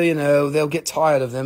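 ordinary delivery

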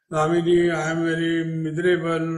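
quick, sing-song plaint of a self-pitying visitor; whining complaint. Retold or imitated. Visitor tells the Swami she is miserable, whining sing-song. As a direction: moan it like you want sympathy.

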